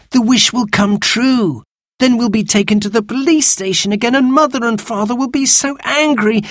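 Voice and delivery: put-on high, anxious child's protest; dismayed, worked-up child; alarmed. A narrator's reading. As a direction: fret aloud at a high pitch. Boy dreads police and angry parents.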